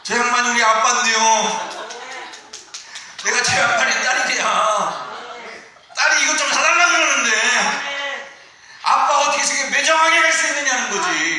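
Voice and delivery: loud, high mock wail of a sulking daughter; mock wailing child. Pastor mimicking his daughter wailing at him in the store, loud and high. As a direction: ham up the child shouting to the crowd.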